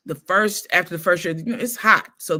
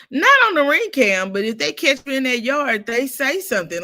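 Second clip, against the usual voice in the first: higher, louder excited reply; excited answer; 7 semitones higher, 5 dB louder than usual. Real, not acted. Answering the question about the ring cam excitedly. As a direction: answer with energy.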